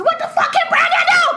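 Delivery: very high, loud exasperated shriek; screamed. Retold or imitated. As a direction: scream it at the mother. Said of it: "I said".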